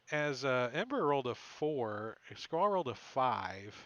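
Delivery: ordinary delivery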